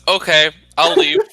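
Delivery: meek agreement. Acted. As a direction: give in right away as Timothy. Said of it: "Timothy says".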